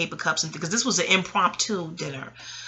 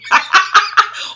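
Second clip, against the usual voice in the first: high laugh; 14 semitones higher, 10 dB louder than usual. Real, not acted. Laughing at mom's calm reply.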